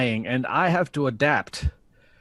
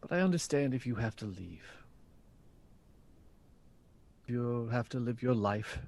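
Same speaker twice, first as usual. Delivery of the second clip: soft, lowered voice letting him go; resigned acceptance; 3 semitones lower, 9 dB quieter than usual. Acted. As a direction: back down quietly and give up the fight. Resigned to letting partner leave.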